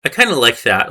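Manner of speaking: normal talking voice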